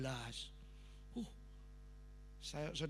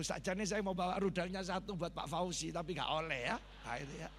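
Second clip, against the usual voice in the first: ordinary delivery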